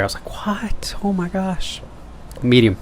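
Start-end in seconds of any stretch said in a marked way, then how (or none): 0.5-1.8 whispered, higher and much softer disbelief; stunned whisper. Retold or imitated. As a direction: gasp it out, hardly able to believe it. Stunned at being hired on the spot.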